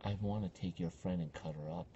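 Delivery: very low, hushed threat; menacing haunted-house character. Retold or imitated. Scare actress threatens a visitor's friend, low and menacing. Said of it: "and she's like".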